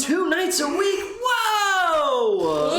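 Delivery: high hammed-up gasp of amazement; overacted astonishment; mock surprise.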